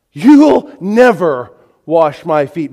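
loud, slow, emphatic refusal; very forceful. Acted. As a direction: refuse forcefully, drawing the words out. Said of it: "Very emphatic, very forceful".

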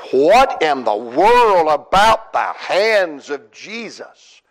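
normal talking voice

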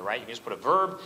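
usual speaking voice

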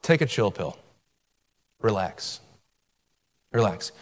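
low, soft, unbothered; talking down the devil, soft and calm; calm dismissal. Retold or imitated. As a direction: wave the devil off calmly. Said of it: "you just look at him and say".

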